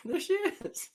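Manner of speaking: laughing high voice. Real, not acted. Laughs as the card is revealed.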